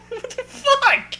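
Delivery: high, slow what the fuck; high, drawn-out disbelief; incredulous. Real, not acted. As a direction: stretch it out in disbelief. Incredulous reaction.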